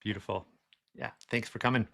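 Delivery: ordinary talk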